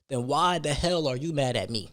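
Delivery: ordinary delivery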